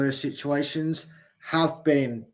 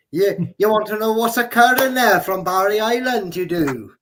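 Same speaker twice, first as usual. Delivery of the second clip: put-on a playful Welsh accent, higher and louder; 6 semitones higher, 5 dB louder than usual. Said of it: "I can't do a Welsh accent, but you can, [name]".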